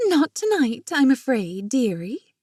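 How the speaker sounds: high, hoity-toity British put-on; posh British accent. A narrator's reading. Jenna reassuring Marina at the restaurant, posh British accent. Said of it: "replied with a posh British accent".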